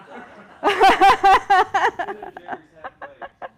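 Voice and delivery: long burst of laughter, high and loud; helpless laughter mid-lesson; loud real laugh. Real, not acted. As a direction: give in to the laugh. Laughing at herself.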